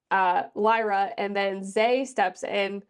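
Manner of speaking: ordinary talk, nothing marked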